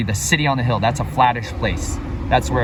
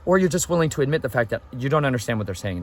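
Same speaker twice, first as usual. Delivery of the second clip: usual speaking voice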